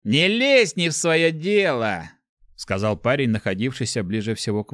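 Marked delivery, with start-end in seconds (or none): 0.0-2.1 high, slow, heated warning; raised, hostile voice; angry warning. A narrator's reading. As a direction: warn him off, stretching the words angrily. Bully warns off the intruder.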